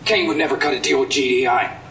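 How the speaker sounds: furious shout. Acted. Loyal soldier shouting treason at Killian. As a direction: explode at the betrayal. Soldier accusing his leader.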